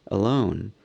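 ordinary delivery, nothing marked

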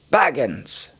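wizard's glad cry of the name; delighted exclamation. Acted. Gandalf spots Bilbo alive, delighted exclamation. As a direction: greet him with sudden joy. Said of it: "he exclaimed".

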